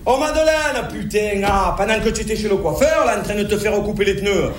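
cheery fast Marseille accent breaking bad news. Marseillais cheerfully telling Madeleine her house burned. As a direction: rattle off the disaster in bright Marseille accent. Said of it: "je le garde mon accent".

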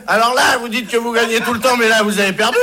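triumphant inspector. Acted. Inspecteur triomphant.

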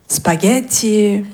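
ordinary talk

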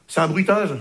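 normal talking voice

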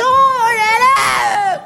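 high slow awed cry. Retold or imitated. Man amazed the president speaks to him, high slow awe. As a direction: marvel at it, drawn out high.

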